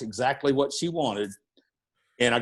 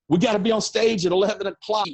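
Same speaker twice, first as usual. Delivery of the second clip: higher, louder and quick; panicked; 8 semitones higher, 5 dB louder, faster than usual. Retold or imitated. A promoter to the late road manager, quick panicked alarm. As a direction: rush it out in a panic.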